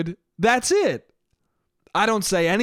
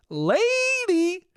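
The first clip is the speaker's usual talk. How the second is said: much higher, drawn-out mocking "lady"; Bill Burr impression; 12 semitones higher than usual. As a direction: stretch "lady" up high like a sneering comic. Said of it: "as Bill Burr would say".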